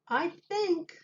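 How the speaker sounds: put-on high, childlike piping; a small boy's high voice; little boy voice. A narrator's reading. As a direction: play the little boy, bright and sure of himself. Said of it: "said the boy".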